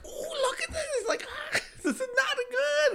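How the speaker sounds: high squealing campy voice, laughing through it; dramatic coworker. Retold or imitated. Coworker reading the search result, high campy squeal. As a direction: shriek it out like a dramatic queen.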